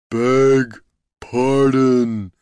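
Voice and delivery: slow, drawn-out ogre roar; huge lumbering roar, each word stretched. A narrator's reading. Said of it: "he roared".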